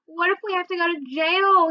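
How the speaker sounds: high, fretful little brother; near tears. A narrator's reading. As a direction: ask it as if about to cry. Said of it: "puckering up like he was gonna cry".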